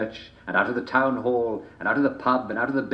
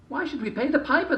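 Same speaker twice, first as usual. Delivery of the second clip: high, scornful villager voice; villagers mocking. A narrator's reading. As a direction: sneer it as a greedy townsman. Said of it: "they asked each other".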